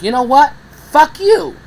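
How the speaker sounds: sudden high, loud shout of defiance; furious outburst. Retold or imitated. Speaker yelling at God he felt ignored him, furious shout.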